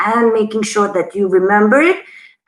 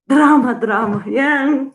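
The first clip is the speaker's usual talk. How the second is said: mock dramatic. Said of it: "I am a dramatic person".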